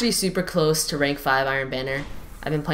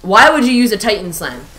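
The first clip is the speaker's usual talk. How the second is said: higher, louder protest; indignant complaint; 7 semitones higher, 9 dB louder than usual. Real, not acted. Gamer protesting to the Titan who slammed him, indignant. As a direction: demand why he got slammed.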